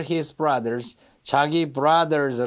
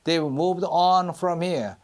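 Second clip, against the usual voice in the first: "on" drawn out and stressed; 2 semitones higher, much slower than usual. Shown as a demonstration. Teacher models the verse for students, stress on "on". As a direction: slowly, lean on the word "on". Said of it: "on에 강세가 들어가죠".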